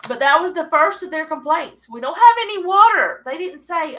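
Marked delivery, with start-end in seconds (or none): 1.9-3.2 loud whiny grumble, pitched up and louder; mock complaint. Preacher voicing the grumbling Israelites, whiny and loud. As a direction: complain like thirsty people in the desert.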